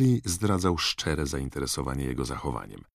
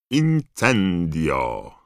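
loud, drawn-out spell call; 3 semitones higher, 6 dB louder, much slower than usual. A narrator's reading. Mr Weasley casting a spell at the fireplace, calling out. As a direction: call out the incantation slowly and loudly. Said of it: "zawołał pan Weasley".

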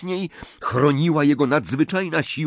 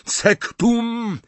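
high-pitched, furious cry; shouted spell; 11 semitones higher than usual. A narrator's reading. Harry hating Snape, casting with a shout. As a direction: hurl out the curse.

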